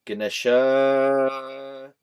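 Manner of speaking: "Ganesha" very slow, last syllable held long and loud; guh-nesh-AAH, stretching the end of "Ganesha"; drawn-out Ganesha. Shown as a demonstration. Showing the extra effort, "Ganesha" with a long final syllable. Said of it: "adding extra syllables for me to say".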